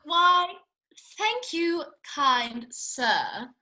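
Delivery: curtsy with your voice, hammed-up Regency lady; mock courtly lady. [name] in costume, mock courtly.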